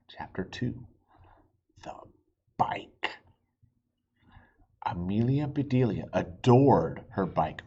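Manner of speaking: lively read-aloud for little listeners; storybook reading. A narrator's reading. Dad reading a chapter book to his kids, animated. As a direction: read it like bedtime story time.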